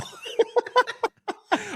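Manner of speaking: high, far laugh at the memory; burst of laughter. Real, not acted. Laughing at his own story.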